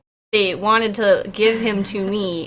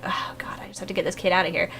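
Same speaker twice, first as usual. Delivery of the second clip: low, soft, rushed aside; stressed muttering; 6 semitones lower, 9 dB quieter than usual. Retold or imitated. Worrying how to get the kid out, stressed muttering. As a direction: mutter it under your breath, fretting. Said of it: "So I'm stressing out a lot".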